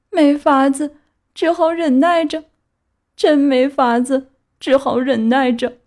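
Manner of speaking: high, sighing donkey lament, slowing down; resigned lament. A narrator's reading. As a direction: resign yourself with a sigh. (皮诺乔无奈哀叹)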